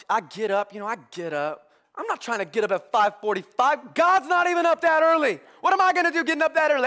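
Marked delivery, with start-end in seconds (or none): 3.9-6.9 much higher and louder, fast rant; riled-up preacher playing it for laughs; comic outrage. Real, not acted. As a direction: go off about getting up early. Ranting about waking early.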